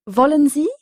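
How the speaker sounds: "Wollen" begun with a V sound, a lively question; W as a French V. Shown as a demonstration. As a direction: model "Wollen Sie?" with a clear V. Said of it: "le W allemand se prononce comme un V français".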